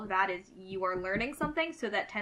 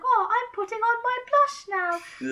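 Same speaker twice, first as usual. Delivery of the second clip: much higher, slow, mocking fake British accent; bad British accent; 13 semitones higher, 7 dB louder than usual. Mocking a beauty blogger putting on blush, bad British accent. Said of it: "they'll put on a bad British accent".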